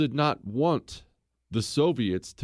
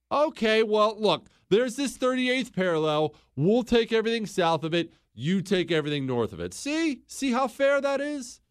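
mock fair deal; 8 semitones higher than usual. Mock America offering deal.

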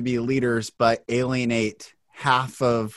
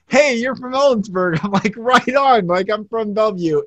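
bright, high, loud hello to a stranger; an overly excited hey; eager greeting; 7 semitones higher, 5 dB louder than usual. Retold or imitated. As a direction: bounce up to a fellow Washingtonian. Acting out his eager first greeting.

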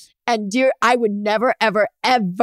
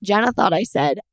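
usual speaking voice

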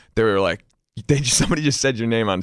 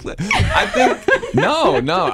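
flustered laugh; 10 semitones higher, much slower than usual. Real, not acted.

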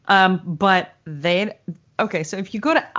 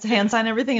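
ordinary delivery

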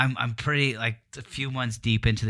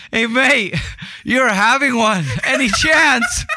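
high, loud mock British complaint; British accent; 12 semitones higher, 6 dB louder, slower than usual. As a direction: whine like a woken British bloke, laughing at it. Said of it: "British guy".

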